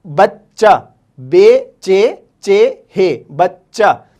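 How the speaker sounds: slow spelled बच्चा, doubling च; doubled ch demo. Shown as a demonstration. Teacher spells बच्चा, stressing the double च. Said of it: "चे का इस्तेमाल दो बार होगा".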